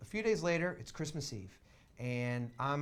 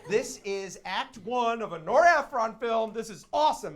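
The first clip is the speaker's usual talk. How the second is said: louder, higher, giddy declaration; thrilled inner monologue; 5 semitones higher, 7 dB louder than usual. Retold or imitated. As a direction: exclaim it like a dream coming true. Acting out his thrilled thought.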